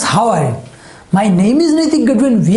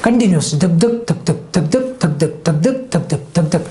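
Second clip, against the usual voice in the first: heartbeat imitation; 5 semitones lower than usual. Shown as a demonstration. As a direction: tap out the heartbeat with your voice, fading away. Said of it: "तो हमारे heart में भी ऐसा होता है". (धीमी, धड़कन जैसी धक धक)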